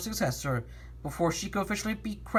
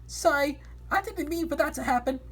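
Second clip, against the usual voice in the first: higher apologetic voice; 9 semitones higher than usual. Acted. Character apology in story.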